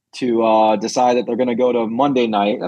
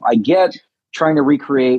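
ordinary talk

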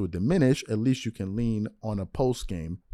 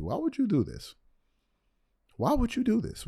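exasperated; 10 semitones higher, 6 dB quieter than usual. Real, not acted. Exasperated question to Gilbert.

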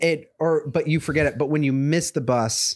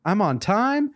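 higher-pitched happy exclamation; pleased lucky commuter, pitched up; delighted; 9 semitones higher than usual. Retold or imitated. Voicing someone who just caught the train, delighted.

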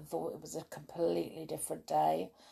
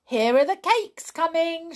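much higher, louder call; shouted; 11 semitones higher, 8 dB louder than usual. Acted. Perry spotting the baker's van, excited shout. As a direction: call out the news, thrilled. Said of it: "shouted Perry".

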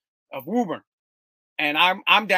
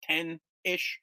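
ordinary talk, nothing marked

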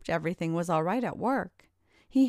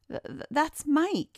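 higher, halting stutter on the first word; stammering; 8 semitones higher than usual. A narrator's reading. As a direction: trip over the start, caught off guard. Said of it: "he stammered".

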